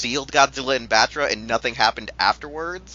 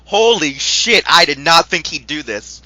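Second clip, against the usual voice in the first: loud, high-pitched astonishment; stunned disbelief; 4 semitones higher, 7 dB louder than usual. Acted. Taro watching Godzilla in the lava, stunned. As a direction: blurt it out, amazed and loud. Voices stunned character's reaction.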